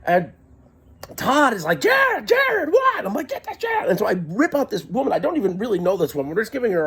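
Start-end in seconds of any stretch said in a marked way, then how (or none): 1.8-3.0 very high hammed-up calling; shouted exchange. Driver calling to his frantic friend, acted in high cries. 3.3-3.9 very high-pitched mock yell; frantic cry. Frantic passenger answering the driver, acted in a high cry.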